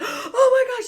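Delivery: loud, high, laughing gasp; laughing shock. Retold or imitated. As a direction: squeal through laughter. Laughing reaction at awards.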